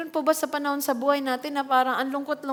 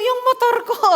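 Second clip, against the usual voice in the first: much higher and louder mock distress; mock wail over the bike; 11 semitones higher, 6 dB louder than usual. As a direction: cry out for your motorbike, hammed up. Parent worried about the bike. (eksaheradong pag-aalala, mataas at malakas)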